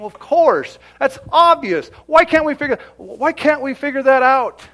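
high, forceful outburst; loud exasperation. Real, not acted. Preacher challenging the congregation on companions, loud exasperation. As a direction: raise the voice in disbelief at the obvious.

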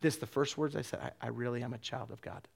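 hushed, low recollection of being moved to tears; quietly overcome, retelling it; tearful realization. Retold or imitated. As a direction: let it drop soft and low, humbled. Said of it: "tears start to flow down my face".

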